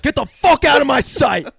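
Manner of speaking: high, loud acted bellow; hammed-up yell of dismissal; mock furious shout. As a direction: roar it as the angry teacher. Imitating the actor staying in character.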